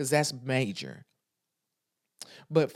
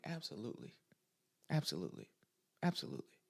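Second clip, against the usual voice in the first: repeated absolutely getting softer to a whisper; fading absolutely; 5 semitones lower, 12 dB quieter, faster than usual. Retold or imitated. Answering a caring friend, fading to a whisper. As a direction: let each absolutely trail off quieter.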